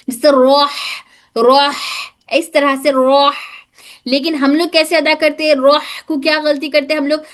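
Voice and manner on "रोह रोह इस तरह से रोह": रोह with a breathy, pushed ह from mid-throat; middle throat h. Shown as a demonstration. Teacher demonstrating the correct रोह to her students. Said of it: "ये हा हलक के बिल्कुल दरमियानी हिस्से से".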